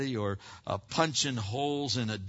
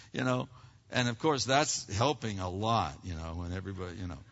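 ordinary talk

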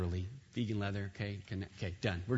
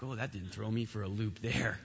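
leise, ironisch; 7 semitones lower, 9 dB quieter than usual. Retold or imitated. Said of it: "And you're like".

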